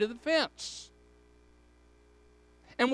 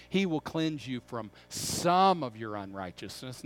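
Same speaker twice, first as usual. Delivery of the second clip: low, softer line with "some" swelling out; stress on SOME; 9 semitones lower, 4 dB quieter than usual. Shown as a demonstration. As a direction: lean hard on "some" as the wrong word. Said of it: "it's not the word some there".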